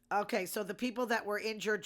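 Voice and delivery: ordinary delivery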